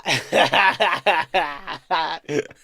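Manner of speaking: long burst of laughter. Real, not acted. Streamer cracking up at a comment about the acting, long laugh.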